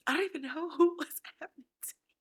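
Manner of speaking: high, quick tearful confession breaking into laughter; emotional. Real, not acted. Host bewildered by her own reaction, choking up. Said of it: "I'm getting so emotional".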